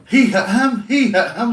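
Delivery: loud, high-pitched mock alarm; mimicked shocked outcry. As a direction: mimic stunned mates crying out. Stunned mates react to punch.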